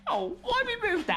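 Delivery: squeaky high parody of him; high mocking echo. Echoes partner's line, mocking.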